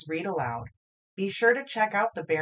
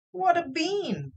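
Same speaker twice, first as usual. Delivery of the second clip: put-on high, slow, amazed boy; boyish wonder; 10 semitones higher than usual. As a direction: marvel at it like a wide-eyed kid. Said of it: "said Jack".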